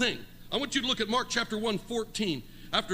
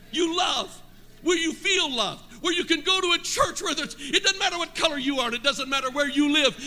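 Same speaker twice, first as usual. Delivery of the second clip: full-force preaching; 6 semitones higher than usual. Acted. Pastor stirring the congregation about love, full force. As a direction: preach it out to the whole room.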